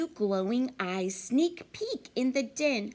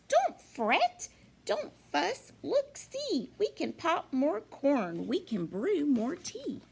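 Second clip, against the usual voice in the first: much higher tiny mouse voice; squeaky mouse; 6 semitones higher than usual. Acted. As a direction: squeak it out to comfort the bear. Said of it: "Mouse squeaks".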